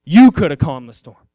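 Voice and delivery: gently scold, then swell on the last line; preacher voicing Jesus rebuking his disciples, low then rising; low rebuke. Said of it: "He said".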